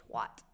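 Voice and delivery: "twat" said as "twot"; American twat pronunciation. Shown as a demonstration. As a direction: give "twat" the vowel of "hot". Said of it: "that sounds ridiculous".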